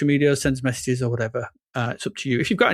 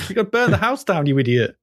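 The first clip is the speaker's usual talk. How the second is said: mock exasperated scolding; 5 semitones higher than usual. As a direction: start high, drop on the insult.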